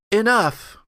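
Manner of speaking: a sharp high shout to stop the fight; high, ringing shout of Enough; commanding shout. Acted. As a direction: cry out to halt everyone. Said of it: "she says".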